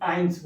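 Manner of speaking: आइंस repeated softly as German "eins"; quiet repeat of आइंस; German pronunciation. Shown as a demonstration. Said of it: "क्या बोलता है?".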